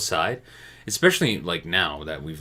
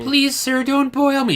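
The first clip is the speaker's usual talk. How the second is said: tiny high-pitched begging voice; pleading lobster; 6 dB louder, slower than usual. Pretend. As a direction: squeal it like a lobster begging for its life. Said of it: "That's all we would hear".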